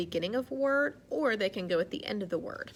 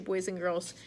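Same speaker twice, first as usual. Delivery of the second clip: ordinary delivery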